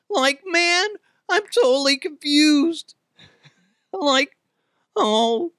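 very high, cracking cartoon slacker voice; Shaggy. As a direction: squeal it like a baffled cartoon sidekick. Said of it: "asking me to do the Shaggy".

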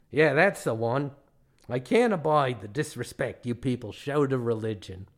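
indignant put-on Australian accent; mean Australian guide. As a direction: complain in a broad Australian accent. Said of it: "the tale of the meanest man in Australia".

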